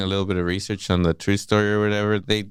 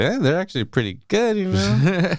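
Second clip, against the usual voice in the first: higher, quick dismissive shrug; mock nonchalance; 10 semitones higher than usual. Husband defends the fake bags to his wife, mock nonchalant. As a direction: brush it off lightly, pitched up.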